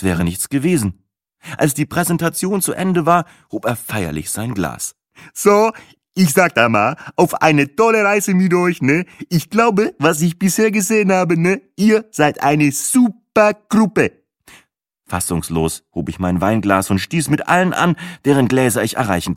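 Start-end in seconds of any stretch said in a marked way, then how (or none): 5.3-14.1 put-on high, accented, celebratory toast; tour guide toasting. Guide raises a glass to the group, toasting in high voice. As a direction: proclaim it proudly, stretching the final praise.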